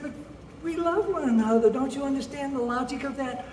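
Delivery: high-pitched, hammed-up young lover; pleading daughter. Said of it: "She started crying".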